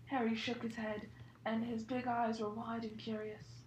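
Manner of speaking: ordinary talk, nothing marked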